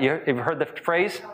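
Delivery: normal talking voice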